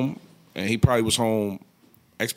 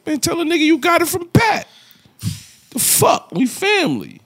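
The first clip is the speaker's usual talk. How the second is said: very high-pitched retold protest; mock indignation. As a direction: imitate an affronted friend, voice way up. Acts out friend's indignant reply.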